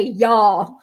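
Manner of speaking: mocking a fake Southern drawl; phony Southern y'all. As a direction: say y'all like a New Yorker faking Southern. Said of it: "That sounded so phony".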